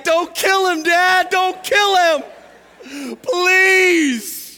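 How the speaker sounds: high, loud, slow crying plea; mock pleading cry. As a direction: wail for mercy, hammed up. Brothers begging dad mid-whooping.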